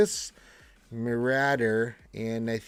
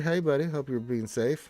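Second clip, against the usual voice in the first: ordinary talk, nothing marked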